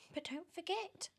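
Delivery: very high, sneering witchy voice; high mocking hag voice; spiteful Aunt Spiker. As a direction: jab at her sister with a high sneer. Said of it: "Aunt Spike cried".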